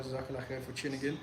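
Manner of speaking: ordinary delivery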